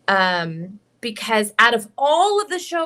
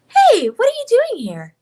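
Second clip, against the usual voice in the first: put-on much higher, louder anime girl; Himiko voice; 13 semitones higher, 7 dB louder than usual. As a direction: lift your own voice up high. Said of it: "just a little bit higher".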